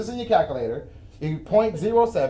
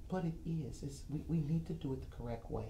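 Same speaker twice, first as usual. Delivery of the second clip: softer, put-on voice of his careful coworker; detail-oriented coworker impression; 16 dB quieter than usual. Host imitates his detail-oriented colleague for viewers, softer put-on voice. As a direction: mimic the detail-focused colleague softly. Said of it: "she talks like this".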